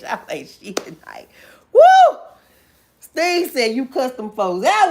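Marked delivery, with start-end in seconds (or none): 0.0-1.3 a soft unvoiced aside to the chat; whispered tease. Real, not acted. Teasing the chat under her breath. 1.7-2.2 a very high, loud woo; joyful whoop. Real, not acted. Burst of joy after teasing.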